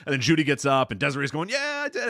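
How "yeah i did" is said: high-pitched mimicked cheer; mock jubilation. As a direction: imitate a wrestler celebrating in a high voice. Retelling the winner's celebration.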